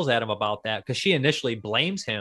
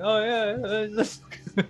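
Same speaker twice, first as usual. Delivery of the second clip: drunk Jack impression; 9 semitones higher than usual. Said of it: "He's like".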